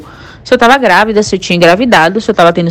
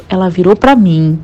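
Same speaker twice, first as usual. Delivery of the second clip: ordinary delivery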